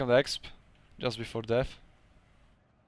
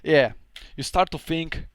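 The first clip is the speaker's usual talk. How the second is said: normal talking voice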